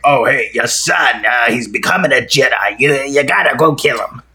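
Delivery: hammed-up possessed Emperor; mock exorcist voice. Host mimics the Emperor ordering Vader, mock exorcist voice. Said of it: "The exorcist voice".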